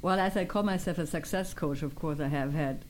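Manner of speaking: usual speaking voice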